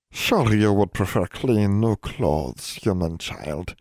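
lightly voiced sly fae urging. A narrator's reading. Fae lady urging the human child to take clothes. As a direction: coax her gently, sly. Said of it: "Siatani urged".

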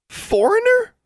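very high, affronted squeak; indignant squeak. A narrator's reading. Anna takes offence at being called foreign, indignant squeak. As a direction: squeak it in outraged disbelief. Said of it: "squeaked Anna indignantly".

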